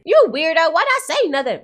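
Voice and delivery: high, loud, teasing yell at the screen; loud mock outrage. Real, not acted. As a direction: call him out, half laughing. Reactor yelling at character.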